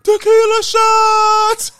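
very high, loud, drawn-out whoop; piercing mock party yell, stretched long; party shout. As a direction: holler it like a drunk party crowd. Imitating friends' fateful party cry.

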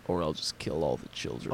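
understated villain turn; mock sudden menace. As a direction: drop into cold menace. Deadpan evil turn.

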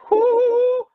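high, loud whoop of joy; excited whoop. Retold or imitated. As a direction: cheer like you've been found out. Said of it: "I'm like".

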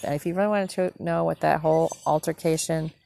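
ordinary talk

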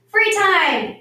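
high, loud gleeful cry; yelling. A narrator's reading. Children hearing the bell, yelling happily. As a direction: yell it like children let loose. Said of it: "yelled the children".

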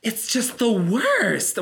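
high, drawn-out tooth fairy voice. Retold or imitated. Big gym guy complaining about the delay, in a tooth fairy voice. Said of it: "the tooth fairy ends up being behind me".

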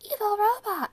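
high, loud mock robot voice; evil robot impression, high and punched out. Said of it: "I sound like a".

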